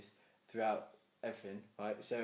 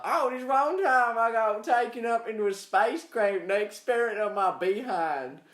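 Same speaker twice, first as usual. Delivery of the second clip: higher, slow, louder hammed-up yokel; rural abductee; 8 semitones higher, 7 dB louder than usual. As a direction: tell the abduction like a village farmer, overplayed. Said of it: "Like, like".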